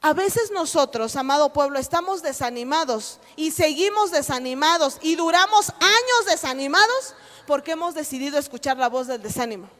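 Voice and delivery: ordinary delivery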